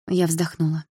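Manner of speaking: plain reading voice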